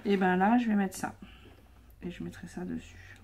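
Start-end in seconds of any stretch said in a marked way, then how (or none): 2.0-3.2 whispering. Real, not acted. Quiet aside while working.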